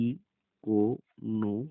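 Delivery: ইকোনমিক্স very slowly, each syllable apart with pauses. Shown as a demonstration. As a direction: separate the opening ই and every syllable of ইকোনমিক্স. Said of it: "সম্পূর্ণ পৃথকভাবে হয়েছে". (ইকোনমিক্স শব্দটি খুব ধীরে, ভেঙে ভেঙে)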